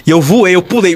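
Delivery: usual speaking voice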